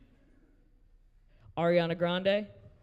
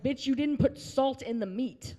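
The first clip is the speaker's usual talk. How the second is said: slow, higher, exasperated comeback; exasperated retort; 8 semitones higher than usual. Retold or imitated. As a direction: spell it out to the sister-in-law slowly and with disbelief. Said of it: "I'm like".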